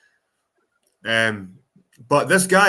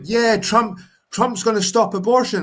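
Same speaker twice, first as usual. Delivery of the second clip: high, loud mock of Trump fans; mocking Trump supporter; 8 semitones higher, 4 dB louder than usual. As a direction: parody the cheering Trump supporter. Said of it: "everyone's like".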